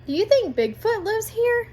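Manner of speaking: put-on high, eager child's question; wide-eyed question. A narrator's reading. Pete asks if Bigfoot lives nearby, high and eager. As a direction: ask it up high like an excited kid.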